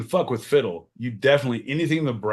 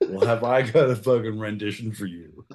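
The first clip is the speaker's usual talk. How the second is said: usual speaking voice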